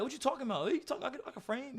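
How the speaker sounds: high-pitched disbelieving protest; indignant high protest. Retold or imitated. To the sparring partner who says stop framing, high indignant protest. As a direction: squeak out the denial. Defending his framing to opponent.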